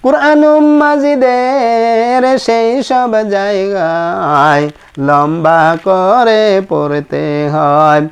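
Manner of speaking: drawn-out chanted recitation of a rule; slow sing-song teacher chant; slow recited rule. Shown as a demonstration. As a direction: intone it slowly like a classroom rhyme.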